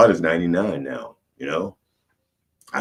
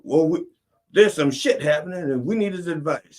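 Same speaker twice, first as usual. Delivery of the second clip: higher, louder mock official; officials pleading; 9 semitones higher, 3 dB louder than usual. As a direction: explain the crisis, urgent. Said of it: "And they go".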